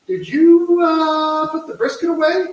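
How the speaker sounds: high-pitched, alarmed question; high alarmed quote. Retold or imitated. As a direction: squeak it out in sudden alarm. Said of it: "And I said".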